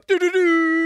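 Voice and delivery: loud, high doo-doo-doo horn; imitated horn. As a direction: mimic a party air horn with your voice.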